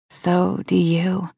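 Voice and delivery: slow, softly breathed reply. A narrator's reading. Amber answering Brock's compliment, slow and breathed. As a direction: let the words ride on breath, slowly. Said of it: "I breathe".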